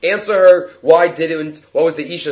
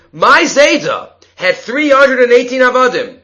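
raised, higher insistent plea; woman's plea; 6 semitones higher, 4 dB louder than usual. Acted. Woman pleads before Rav Nachman, raised and insistent. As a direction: press her claim to the rabbi.

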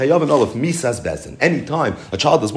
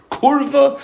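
"kurva" punched much higher and louder; one-word emphasis; 13 semitones higher, 3 dB louder than usual. Shown as a demonstration. Teacher highlighting Rashi's one-word explanation, sharply stressed. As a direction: spike the single word "kurva" high and strong. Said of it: "Rashi, one word".

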